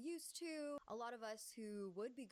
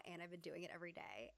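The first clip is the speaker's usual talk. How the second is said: normal talking voice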